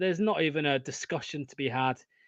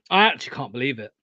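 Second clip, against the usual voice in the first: normal talking voice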